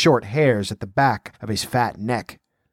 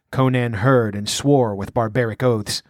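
ordinary delivery

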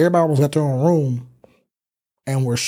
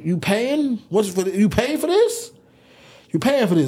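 incredulous; 7 semitones higher than usual. Retold or imitated. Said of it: "I said".

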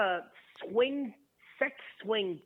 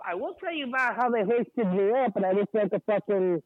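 muffled, mouth right on the phone; too close to the mic, muffled; swallowed voice; 5 dB louder than usual. Real, not acted. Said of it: "You just sound like you swallowed the phone".

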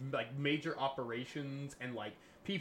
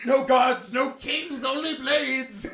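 high shouted mad-doctor proclamation; hammed-up fanatical battle cry; ghoulish doctor; 10 semitones higher, 12 dB louder, much slower than usual. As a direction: bellow it like a crazed ghoul, drawn out. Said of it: "really ghoulish, ghoulish doctor".

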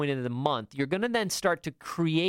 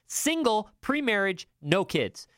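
ordinary talk

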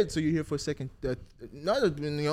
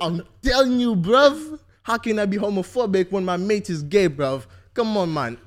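put-on loud, raised English street-slang accent; 5 semitones higher, 7 dB louder than usual. Host doing his best English accent for friends, getting louder. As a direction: protest like an animated English lad, hammed up. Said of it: "I'm gonna do mine".

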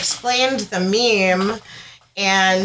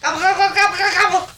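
gobble like a turkey; playful turkey impression; 12 semitones higher, much faster than usual. Turkey noise for Thanksgiving.

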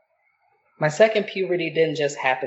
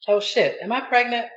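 mock panic; 9 semitones higher than usual. Mock panic about pregnancy.

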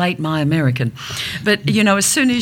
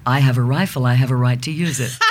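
lower-pitched mock American man; American accent; 6 semitones lower than usual. Dialect coach's example of the American way, low American accent. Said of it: "how you say it in the American accent".

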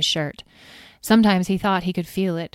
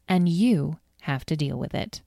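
ordinary delivery, nothing marked

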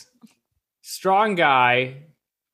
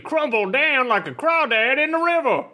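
hammed-up Southern accent, higher than usual; 7 semitones higher than usual. Warning Goku about the coming fight, in a Southern accent. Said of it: "Can you do a Southern accent?".

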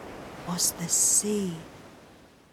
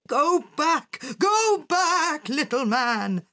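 raised, higher warning cry; urgent cry; 5 semitones higher, 3 dB louder, slower than usual. A narrator's reading. Philosopher warning the little man off the sea, crying out. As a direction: cry out a warning, pitched up then easing. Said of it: "cried the philosopher".

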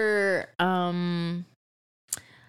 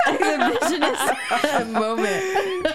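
laughing through exhibitionist, slowly; giggling as she finally gets exhibitionist out; 7 semitones higher, slower than usual. Real, not acted. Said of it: "how do you say it?".

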